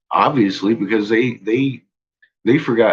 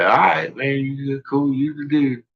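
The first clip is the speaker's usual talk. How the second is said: lightly voiced higher, easygoing yes-men; naive agreeing people; 6 semitones higher than usual. The people agreeing with Marduk, in a lightly higher mock gullible voice. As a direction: go along with him like a gullible crowd. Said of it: "And then we was like".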